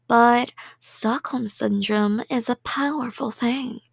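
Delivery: ordinary delivery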